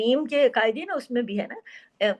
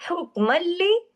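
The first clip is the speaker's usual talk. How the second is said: recited हुक्मल्ली, pitched higher; recitation demo; 6 semitones higher, 3 dB louder than usual. Shown as a demonstration. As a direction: chant हुक्मल्ली as in tilawat. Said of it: "देखिए मीम दो जबर मन है". (हुक्मल्ली तिलावत में)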